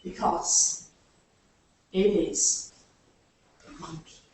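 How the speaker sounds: hushed, suspenseful whisper; a secret whispered to the children before the reveal. Acted.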